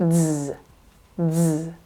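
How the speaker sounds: a buzzing Z with vibration; voiced z. Shown as a demonstration. Teacher letting learners hear the vibration, voiced z. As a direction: hold Z with the voice on. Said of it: "Can you hear that vibration?".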